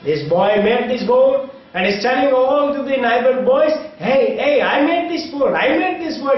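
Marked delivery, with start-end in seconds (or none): 4.0-6.4 put-on high-pitched, proud boy calling to playmates; excited boasting. Boy telling neighbor boys about his boat, excited boasting. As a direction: imitate a boy showing off, pitch lifted.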